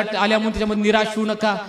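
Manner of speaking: ordinary talk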